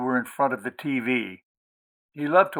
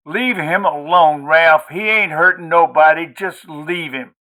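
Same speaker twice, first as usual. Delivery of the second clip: loud pleading; 4 semitones higher, 7 dB louder than usual. Acted. Wife pleads with drunk husband.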